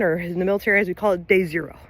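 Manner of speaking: ordinary delivery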